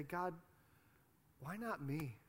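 soft, halting plea to God; pleading prayer. Retold or imitated. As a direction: voice someone's hushed prayer, with long pauses. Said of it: "and you say".

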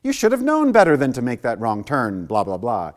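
loud, high berating that trails into dismissive blahs; exaggerated tirade, starting high and coming down; mock scolding. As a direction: tell off a foolish follower, hammed up. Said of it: "He doesn't say".

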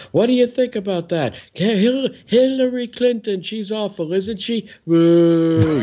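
high-pitched mocking rally patter; mimicking a crowd-baiting comedian, ending in a boo; insult-comic shtick. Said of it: "he's doing a shtick".

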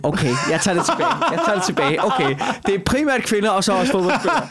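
laughing while backing down. Real, not acted. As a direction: give in with a laugh. Concedes point, laughing.

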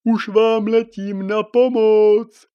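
high, loud call to help, slow. Acted. Little cloud calling out to rescue, high and loud. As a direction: shout across the sky while flying.

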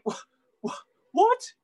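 breathy first, then a high yelped repeat; acted double-take, jumping sharply up in pitch; stunned disbelief. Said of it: "And you just think".

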